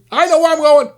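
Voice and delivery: high, loud furious shout; angry shout. Retold or imitated. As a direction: bark it right back, indignant. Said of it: "screaming at me, yelling at me".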